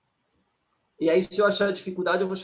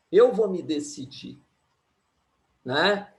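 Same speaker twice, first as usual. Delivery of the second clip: normal talking voice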